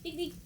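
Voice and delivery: high soft imitated ding. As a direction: tap it out high and light. Imitated cabinet touch sound.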